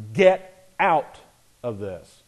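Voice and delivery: emphatic "get out", pause, hushed "of this"; stress on get out. Shown as a demonstration. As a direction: hit "get" and "out" as separate words. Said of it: "I emphasize those two words".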